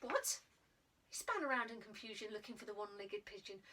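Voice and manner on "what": surprised. A narrator's reading. Boy sees girl, confused.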